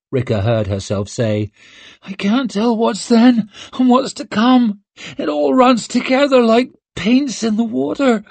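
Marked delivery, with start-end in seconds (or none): 2.0-8.2 put-on high feverish girl voice. Rika delirious in pain.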